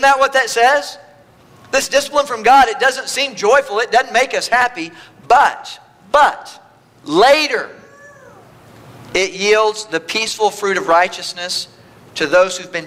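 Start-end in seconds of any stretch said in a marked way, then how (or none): 5.3-7.7 emphatic. Real, not acted. Preacher's rising build.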